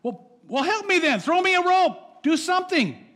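high, loud frantic begging; frantic kid. Retold or imitated. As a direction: demand a rope like a panicked kid. Kid begging God for rope.

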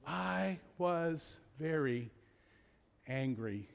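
slow, softening at the end; measured admission. Retold or imitated. Preacher quoting Nehemiah's verse to the congregation, slow measured admission. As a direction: read it slowly and deliberately, dropping away.

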